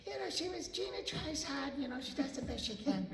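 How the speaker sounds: high, soft put-on husband voice; Pat impersonation. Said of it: "[name]'s impersonation of".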